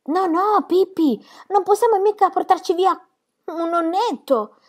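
put-on high, alarmed child protest; boy protesting. A narrator's reading. Tommy protests to Pippi about the old man, high and alarmed. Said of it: "disse Tommy".

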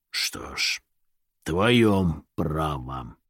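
eerie, drawn-out whisper from nowhere; ghostly unseen voice, very slow; dark low voice. Acted. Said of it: "мрачный и низкий".

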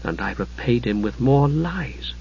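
slow, very soft self-reproach. A narrator's reading. Usha blaming herself over Palin, quietly berating herself. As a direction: quietly scold yourself. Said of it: "she said, berating herself".

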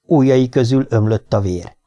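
ordinary delivery

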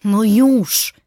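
mild snarl; snapping. A narrator's reading. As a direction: snap back at a nagging friend. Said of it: "warknęłam".

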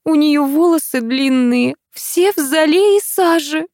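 high, drawn-out lament; slow high-pitched complaining voice. Acted. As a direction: wail mildly over the mess, slowly. Tamara laments the dirty children.

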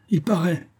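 high-pitched, mocking little reply; ironic retort. A narrator's reading. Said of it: "riposta la voix ironique".

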